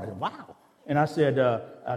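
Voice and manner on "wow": high, retold silent amazement; a higher, softer wow, as if thought privately; mock private astonishment; inward wow. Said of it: "I didn't say that in my heart".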